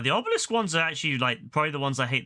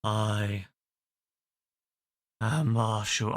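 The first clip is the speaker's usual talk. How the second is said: lower, chesty narrator self-introduction; Marshall narrator impression; 5 semitones lower, much slower than usual. Streamer announcing himself as the narrator, lower voice. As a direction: announce yourself low like the narrator. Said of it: "I can't do it".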